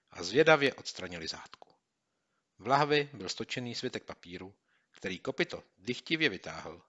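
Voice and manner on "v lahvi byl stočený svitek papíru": ordinary delivery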